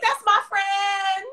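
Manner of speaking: high, slow, drawn-out cheer; proud cheering. Retold or imitated. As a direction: re-enact crowing over a friend's success. Retold proud cheer for a friend.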